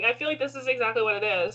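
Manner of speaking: usual speaking voice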